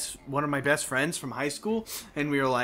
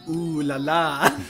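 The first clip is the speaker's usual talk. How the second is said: cheeky ooh la la; much slower than usual. Retold or imitated. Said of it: "And I was like".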